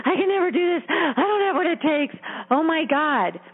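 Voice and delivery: put-on a panicked kid certain it will fail; anxious inner child. Coach contrasting the child with the bully, gasping high panic. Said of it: "can you feel and hear the difference".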